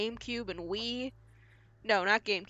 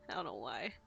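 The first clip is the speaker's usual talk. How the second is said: a softer whispered aside; 10 semitones lower, 9 dB quieter, faster than usual. Real, not acted. Player after a slip of the tongue, whispering. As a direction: whisper it to yourself.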